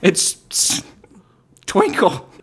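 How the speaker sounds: high, hesitant unicorn voice answering; a shy creature giving its name high. Acted. Unicorn answering the name question in a high voice.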